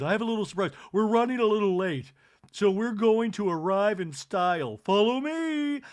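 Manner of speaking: loud, high, grand announcement; bubbly Kathy. Retold or imitated. Kathy leading the ladies outside, loud and high. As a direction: sweep the ladies out the door. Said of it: "Now she goes".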